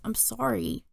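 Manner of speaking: small, soft apology; starting to cry. A narrator's reading. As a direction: apologize softly, close to tears. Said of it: "I started to cry".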